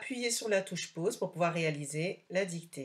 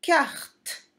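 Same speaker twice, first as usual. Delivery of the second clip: cartes said high and loud, stretched for dictation; named picture word; 8 semitones higher, 6 dB louder than usual. Shown as a demonstration. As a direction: announce cartes clearly to the class. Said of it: "Ici, nous avons des". (mot de dictée, haut et appuyé)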